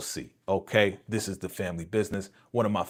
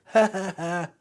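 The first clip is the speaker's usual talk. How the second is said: mock laughter; 8 semitones higher, 3 dB louder than usual. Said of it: "They all laughing".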